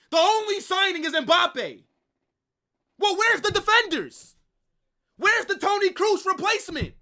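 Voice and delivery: loud, high-pitched outraged rant; furious yelling. Real, not acted. Fan yelling at Perez over the transfer window, furious shout. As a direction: shout your demands at the empty box.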